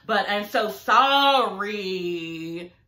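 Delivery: drawn-out, insincere sorry, dragged very slowly; mock fake apology. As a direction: stretch it out like a condescending non-apology. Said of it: "you didn't mean that, and that was condescending".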